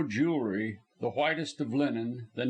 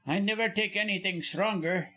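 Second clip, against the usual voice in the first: graceful; 6 semitones higher than usual. Acted. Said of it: "he said, gracefully".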